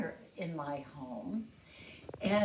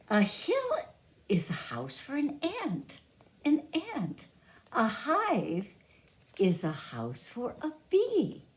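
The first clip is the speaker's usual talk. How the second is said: put-on reading to children; 8 dB louder than usual. Teacher reads picture book aloud.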